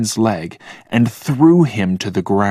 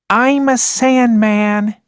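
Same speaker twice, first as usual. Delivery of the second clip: put-on high, loud, slow little-boy cry; child's shout; 14 semitones higher, 4 dB louder, slower than usual. A narrator's reading. Six-year-old Logan playing Sandman, high shout. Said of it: "shouted Logan".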